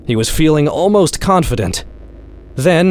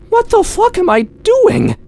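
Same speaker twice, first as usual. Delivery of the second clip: the panic breaks out in a squeak; panicked outburst. Acted. Daniel realizing his shot could break the window, very high panicked cry.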